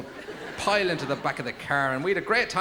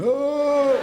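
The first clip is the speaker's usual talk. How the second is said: very high, loud comic dog howl; 12 semitones higher, 8 dB louder than usual. Acted. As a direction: wail like a tongueless dog. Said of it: "A dog going".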